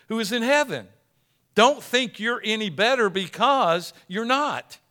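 loud, high, impassioned pulpit voice; full-force preaching. Acted. Preacher hammering husbands and fathers, loud and impassioned. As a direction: hammer it home to the congregation.

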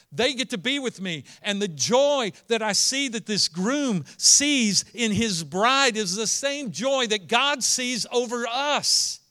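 impassioned preaching. Acted. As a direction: lift the room, pleading and fervent. Preaching builds to passion.

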